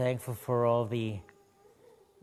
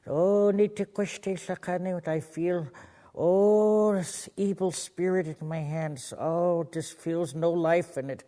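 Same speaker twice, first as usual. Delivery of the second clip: praying healer grandfather; 5 semitones higher, 4 dB louder than usual. Retold or imitated. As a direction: imitate a grandfather fervently praying over someone. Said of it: "He went to pray for this one lady".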